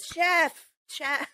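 high-pitched mock chef salute; imitating deferential kitchen greetings. Imitating everyone passing the open kitchen, mock deference. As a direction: pitch it up, each greeting on its own. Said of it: "Everybody comes by and goes".

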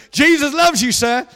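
loud, high-pitched cheerful-sounding call; shouted. Retold or imitated. Preacher calling through the closing door, retold shout. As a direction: shout it quickly before it is too late. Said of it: "And I shouted".

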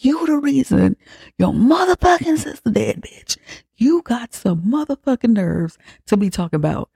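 high-pitched imitated tirade; hammed-up furious accusation, starting very high and easing down; acted losing it. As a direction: tear into them in mock fury, pitch leaping up. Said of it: "She lost her fucking shit".

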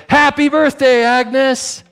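high, loud group-greeting shout; surprise shout. Retold or imitated. Diner crowd surprises Agnes at her party, high celebratory shout. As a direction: shout it like a whole room springing a surprise.

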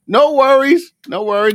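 high, loud breezy reassurance; cheerful. Real, not acted. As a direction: toss it off brightly. Cheerful reply to caller.

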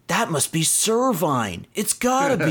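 put-on slow, then high clueless boy; mock naive kid, slow then high; dumb Ash. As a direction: play the trainer as a dimwit. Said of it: "the first thing Ash says is".

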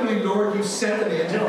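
loud, high, relieved thanksgiving; mock grateful cry. Rescued non-dancer thanking the Lord, loudly relieved. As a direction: exclaim hammed-up thanks to heaven. Comic relief at child's refusal.

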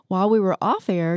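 ordinary talk, nothing marked

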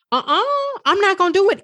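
put-on high, stubborn toddler refusal; toddler tantrum. Brain refusing change like a toddler having a tantrum. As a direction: refuse like a sulking two-year-old. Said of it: "like a two-year-old having a tantrum".

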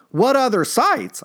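pitched well up, calling down the hallway after him; calling after someone. Retold or imitated. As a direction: call it out after someone walking away. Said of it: "calling out after him".

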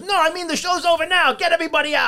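high, urgent mock outburst; worked-up high-pitched angry imitation; mad club owner. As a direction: order everyone out as the irate owner. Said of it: "he's fucking mad".

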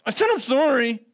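a sulky mock kid; begrudging kid. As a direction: mimic a grudging child. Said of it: "Begrudging acceptance".

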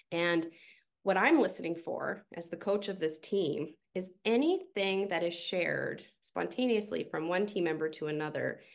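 normal talking voice

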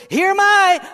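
high, loud eager volunteer; bold, ringing declaration; eager response. Acted. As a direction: call out readily, pitched high and loud. Acting out Isaiah's eager response.

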